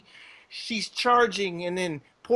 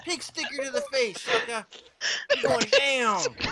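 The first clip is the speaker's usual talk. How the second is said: laughing triumphant taunt; 6 semitones higher than usual. Retold or imitated. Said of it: "she's like".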